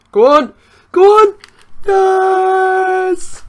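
loud, high-pitched urging into a yes; excited cheer. Real, not acted. Gamer urging the ball into the net, excited shout. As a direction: yell it as the shot goes in. Cheering own goal attempt live.

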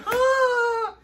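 high, whiny huh; baffled squeak of disbelief; confused huh. Retold or imitated.

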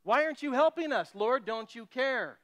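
hammed-up frightened disciples yelling at God; impolite demand. Disciples in the storm yelling at sleeping Jesus, mock-angry outcry. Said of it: "that's not a polite".

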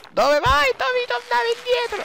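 rising shrill shouts; high panicked yelling. Real, not acted. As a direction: call out to the rider. Yelling at game character.

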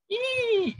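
very high squeaked "ee"; thin, high "eee" for pitch going up; rising pitch demo. Shown as a demonstration. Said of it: "pantatnya itu naik".

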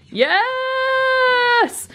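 long, high squealed cheer; elated drawn-out squeal; excited squeal. Real, not acted. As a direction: draw it out high in delight. Celebrating the countdown.

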